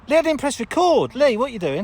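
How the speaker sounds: loud, high call to Lee. Real, not acted. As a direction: call across to Lee, exasperated. Calling out to partner.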